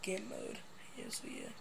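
whisper. Acted. Announcing a mode mid-game.

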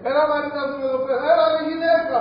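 loud, fast, impatient call to his wife; husband shouting. Retold or imitated. Husband yelling at his wife in a noisy home, loud and fast. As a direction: holler across the house at her. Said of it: "φωνάζει ο άντρας".

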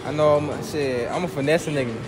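normal talking voice

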